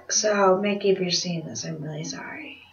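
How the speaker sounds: very soft, low, fading to a whisper; hushed apology. Real, not acted. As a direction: apologize sheepishly, almost under your breath. Direct apology to Mickey.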